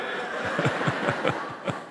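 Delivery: a soft unvoiced chuckle after the joke; breathy laugh. Real, not acted. (riso soprado e baixo)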